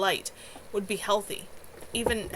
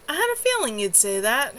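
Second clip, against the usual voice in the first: gloating demon mage; 5 semitones higher, 5 dB louder than usual. Acted. Demon mage grins and taunts.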